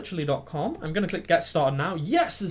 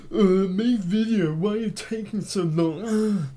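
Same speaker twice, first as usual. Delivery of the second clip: slow, higher, drawling stoned troll voice; dopey stoned commenter nagging for videos; lazy internet troll; 5 semitones higher, 4 dB louder, slower than usual. Retold or imitated. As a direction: whine it like a troll who's been smoking a brick. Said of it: "what the hell accent or impression I was going for there".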